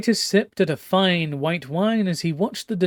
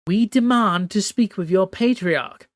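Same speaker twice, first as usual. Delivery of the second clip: high regal voice, commanding and cold; imperious empress; 5 semitones higher, 4 dB louder than usual. Acted. Empress Lawan overruling her nervous captain, haughty high command. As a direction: speak as a haughty sea empress giving orders.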